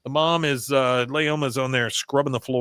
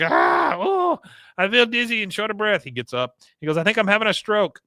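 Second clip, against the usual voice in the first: high, hammed-up gasps of distress; mock fainting son; 8 semitones higher than usual. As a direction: fake a dramatic stroke at the table. Son fakes collapse at dinner.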